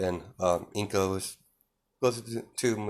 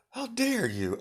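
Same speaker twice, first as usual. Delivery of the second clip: offended; 3 dB quieter than usual. Acted. Inko offended by accusation.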